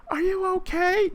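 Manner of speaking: very high, sweet mock lady voice. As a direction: squeak it like a concerned little lady.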